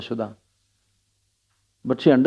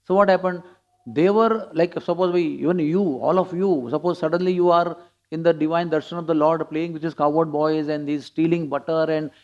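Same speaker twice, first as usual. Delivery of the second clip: normal talking voice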